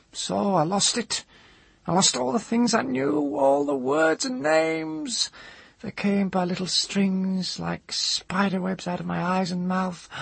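high, keening crone's voice; high-pitched old woman, distraught and wandering; anguished old woman. A narrator's reading. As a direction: lament in a high, strained old voice.

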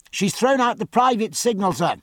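high, carrying voice; calling out a report. A narrator's reading. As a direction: report it up to the officer. Midshipman reports a signal to officer.

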